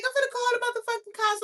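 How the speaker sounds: high, loud, fast outburst; rapid-fire mock hollering; yelled threat. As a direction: imitate a worked-up woman threatening. Acting out the woman's threat.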